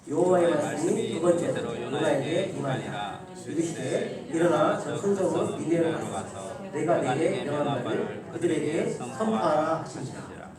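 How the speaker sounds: slow, measured unison Bible reading; scripture reading. Acted. Pastor leading the church in reading Jonah, slow recitation. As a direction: recite God's command slowly and solemnly. Congregation reads scripture aloud.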